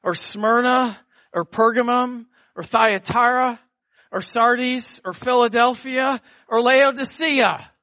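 rising, building preacher cadence; preaching. Acted. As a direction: build the list upward. Preacher listing cities, rising.

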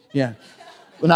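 ordinary talk, nothing marked

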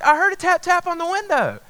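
high-pitched insistent girlfriend imitation; voiced girlfriend. Girlfriend reporting a tap on the window, imitated insistence. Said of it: "And she's like".

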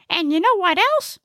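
very high comic character voice; high-pitched little know-it-all; chatty Gnesi. Acted. Gnesi makes conversation while driving, high chatty voice.

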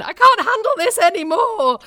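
high-pitched mock wail; protesting wardrobe. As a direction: wail it like an overstuffed wardrobe giving up. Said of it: "my entire wardrobe went".

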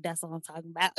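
quiet approval. Retold or imitated. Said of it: "she'd be like".

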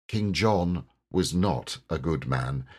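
ordinary delivery, nothing marked

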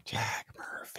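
very soft hushed whisper. Pretend. Host whispering the name back to cohost. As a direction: breathe the name out secretly.

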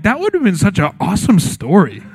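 excited kid's awe, lightly imitated; mock childish enthusiasm, swooping pitch; eight-year-old. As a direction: gush like a thrilled little boy. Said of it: "as an eight-year-old".

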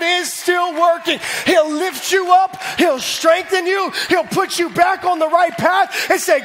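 full-force preaching. Acted. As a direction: drive it home to the congregation.

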